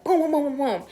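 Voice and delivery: laughing boom boom knocks; mock banging on the cell door; imitated door banging. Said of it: "I started banging on the door, like".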